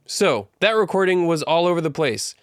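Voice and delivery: usual speaking voice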